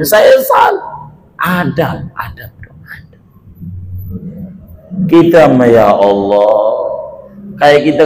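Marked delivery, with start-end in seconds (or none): 0.0-1.1 high, slow cried admission; anguished cry. Acted. 1.4-3.2 fading call. Acted. Calling, fading to nothing.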